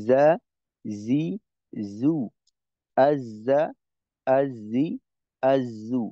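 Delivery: "জা", "জি", "জু" as Arabic jeem syllables, each said separately and clearly; Arabic letter jeem demo. Shown as a demonstration. Teacher showing students how jeem sounds, clear syllable drills. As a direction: say "আজা", "আজি", "আজু" slowly, one at a time, jeem sound crisp. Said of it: "জায়ের উচ্চারণটা".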